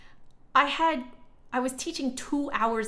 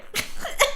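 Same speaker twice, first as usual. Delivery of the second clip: high laugh; 5 dB louder than usual. Real, not acted. Laughing at commenters who miss point.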